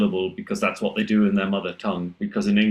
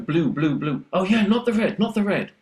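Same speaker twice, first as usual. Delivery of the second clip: listener catching on; 9 semitones higher than usual. Retold or imitated. Voicing listeners catching the word.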